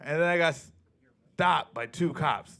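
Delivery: ordinary delivery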